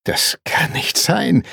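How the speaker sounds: slow, high, whispery disbelief; aghast half-whisper; stunned disbelief. A narrator's reading. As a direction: draw it out, unable to believe it. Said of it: "fassungslos schüttelte er den Kopf".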